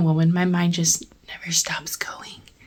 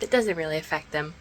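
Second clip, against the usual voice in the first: normal talking voice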